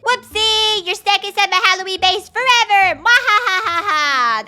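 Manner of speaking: gleeful evil villain gloat; mad scientist. Pretend. Traps friend, evil villain laugh.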